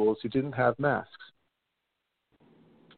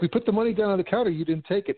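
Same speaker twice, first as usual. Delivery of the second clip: fast, higher defensive protest; protesting shopper; 7 semitones higher, faster than usual. Retold or imitated. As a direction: rush it out as the wronged shopper. Shoppers protesting in acted quarrel.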